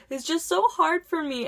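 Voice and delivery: high, whimpering mock sob; imitated crying. Teacher overheard by eavesdropping student, mock tearful whine. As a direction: mimic a tearful teacher on the phone. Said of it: "like, crying and on the phone".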